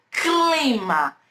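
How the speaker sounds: "clima" drawn out slowly, C and L distinct; C e L audíveis. Shown as a demonstration. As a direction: sound out "clima" very deliberately. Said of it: "Eu pronuncio um pouquinho do C". ("clima" bem devagar, com C e L destacados)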